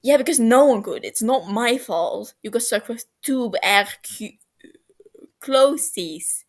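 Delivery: loud and haughty; snooty. Acted. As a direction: turn your nose up at it. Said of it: "turns up his nose".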